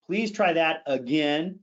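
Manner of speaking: "again" drawn out and emphasized at the end; stress on again. Shown as a demonstration. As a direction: save the weight for "again". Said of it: "Emphasis and intensity are absolutely critical".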